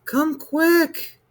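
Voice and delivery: put-on high child's cry for his mother; a scared little boy calling out; frightened cry. A narrator's reading. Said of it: "he cried".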